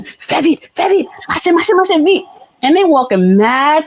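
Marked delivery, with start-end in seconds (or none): 0.0-2.2 urging hurry. Retold or imitated. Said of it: "so I'm telling him".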